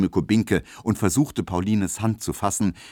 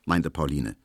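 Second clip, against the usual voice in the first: usual speaking voice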